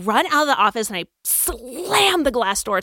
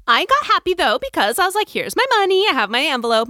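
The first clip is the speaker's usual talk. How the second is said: happy; 7 semitones higher than usual. Retold or imitated. Excited about the paycheck.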